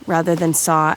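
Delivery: usual speaking voice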